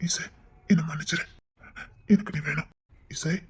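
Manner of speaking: soft, barely voiced sorry; husband whispering an apology to his fainted wife; whispered apology.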